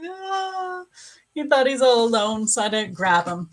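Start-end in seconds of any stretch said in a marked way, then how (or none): 0.0-0.8 high, thin mock wail; imitated cat crying. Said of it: "he's like, crying. He's like".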